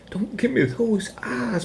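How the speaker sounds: high, slow pet-owner voice; doggy talk. Acted. Owner pleads with his dog Bernard, high slow doggy talk.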